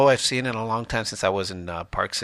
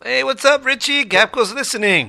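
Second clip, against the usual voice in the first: excited shout-out; 12 semitones higher, 5 dB louder than usual. Real, not acted. Radio shout-out to a listener.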